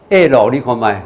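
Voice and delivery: ordinary talk, nothing marked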